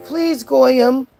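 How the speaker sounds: high, whiny fake pleading ending in a long wailed please; hammed-up tearful begging; mock begging. Streamer imitates heckler pleading for a call, mock begging. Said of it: "begging the Goyim to talk to you".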